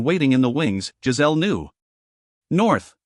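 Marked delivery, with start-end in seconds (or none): 2.5-2.9 sudden, higher cry of the answer. A narrator's reading. Giselle gives in to save the boy, crying out. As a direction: blurt it out to save the child. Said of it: "she cried".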